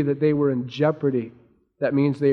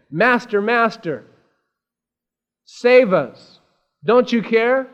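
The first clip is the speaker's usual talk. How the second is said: raised, pleading cries for help; freaking out; 6 semitones higher, 6 dB louder, slower than usual. Retold or imitated. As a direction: plead urgently, higher and louder. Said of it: "they came to him freaking out".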